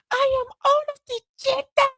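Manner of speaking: very high, squeaky declaration; mock heroic declaration. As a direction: proclaim it like a cartoon heroine. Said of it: "she goes".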